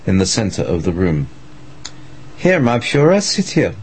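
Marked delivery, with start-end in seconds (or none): 2.4-3.7 high seductive witch voice. Acted. Witch leader beckons Hitler.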